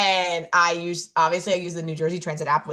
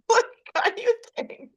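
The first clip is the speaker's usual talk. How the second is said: self scolding; 14 semitones higher than usual. Retold or imitated. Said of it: "self-scolding after hanging up".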